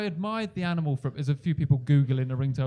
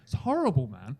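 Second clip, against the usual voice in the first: mild mock outrage; 7 semitones higher than usual. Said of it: "I was livid".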